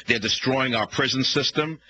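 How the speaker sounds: ordinary talk, nothing marked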